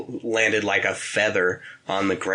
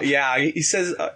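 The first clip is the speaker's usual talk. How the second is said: ordinary talk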